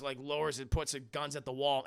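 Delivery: ordinary delivery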